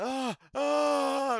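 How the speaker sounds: high, loud teasing ah ah; mocking taunt. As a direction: taunt him playfully. Mock taunting noise, loud high.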